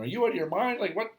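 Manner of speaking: high, incredulous mock question; mock disbelief. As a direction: act baffled, as if he's lost his mind. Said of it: "kinda looking at me going".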